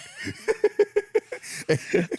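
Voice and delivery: long airy laugh fading down; breathy laugh. Real, not acted. Laughing while telling story.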